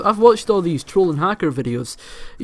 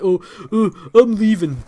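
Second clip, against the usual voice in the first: mocking whiny kid; 5 semitones higher than usual. Mocks the kid quitting.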